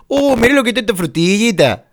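porteño exagerado, agudo y cantado; acento de Buenos Aires. Shown as a demonstration. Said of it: "eso de Buenos Aires tiene que decir". (acento porteño de broma)

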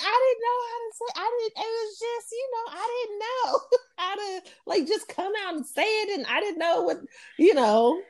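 high, fast, fumbling self-imitation; flustered excuse. Answering her friend's sister, high, fast, flustered. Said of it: "I was like".

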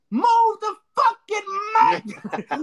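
high, drawn-out scream; heckler's scream. Retold or imitated. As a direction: scream it out slowly like a heckler from the crowd. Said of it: "This random dude screams out".

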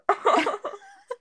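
high, loud embarrassed laugh; burst of laughter. Real, not acted. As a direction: break into a sudden laugh. Embarrassed at her short part.

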